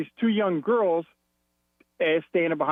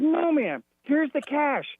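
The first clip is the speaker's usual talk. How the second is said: exasperated protest; 9 semitones higher, slower than usual. Retold or imitated. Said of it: "I said".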